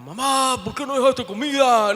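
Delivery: loud demanding whine at mom; bratty kid yelling. Retold or imitated. Preacher voicing a rude kid demanding food from mom, loud whine. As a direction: shout it like a spoiled teen.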